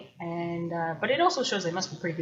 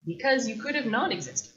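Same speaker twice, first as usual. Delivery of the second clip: ordinary talk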